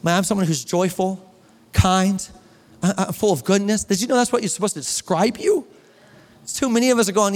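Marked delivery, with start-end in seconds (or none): none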